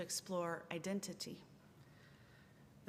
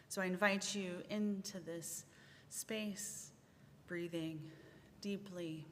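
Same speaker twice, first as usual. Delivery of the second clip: guided centering; 6 dB quieter than usual. Acted. Minister guiding the congregation into centering, softly. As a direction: ease the congregation into stillness.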